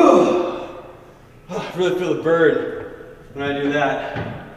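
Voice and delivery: ordinary talk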